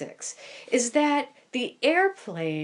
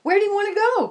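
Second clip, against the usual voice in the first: much higher, louder, bubbling enthusiasm; eager pilot asking where to fly; excited pilot; 13 semitones higher, 7 dB louder, much faster than usual. Retold or imitated. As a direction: gush like a thrilled tour guide. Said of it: "he's really got this energy, and he's so excited".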